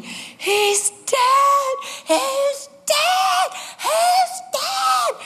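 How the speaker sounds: re-enacted shriek, very high pitched; high, drawn-out mock-hysterical wail; panicked scream. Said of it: "I started screaming".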